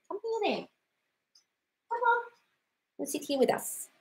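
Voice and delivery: high coaxing call; high, sing-song coax to come closer; calling over. Real, not acted. Host calling someone over to sit, high and coaxing.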